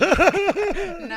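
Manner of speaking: high, loud laughing no. Real, not acted. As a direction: burst out laughing at your own joke. Laughs at her own joke.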